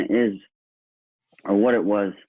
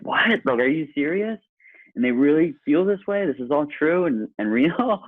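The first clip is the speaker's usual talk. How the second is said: mock disbelief; 9 semitones higher than usual. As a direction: gasp it out like you can't believe them. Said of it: "I'm like".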